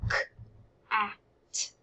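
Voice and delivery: cat split into single sounds k, a, t; segmented sounds. Shown as a demonstration. Teacher modelling sound segmenting for children. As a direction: tap out each sound of cat separately. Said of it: "Three sounds in the word cat".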